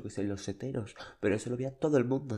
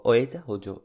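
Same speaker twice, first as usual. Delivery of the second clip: louder, forceful demand; ultimatum shout; 10 dB louder than usual. Retold or imitated. Excluding the girl from the group, shouting. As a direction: throw down the ultimatum loudly.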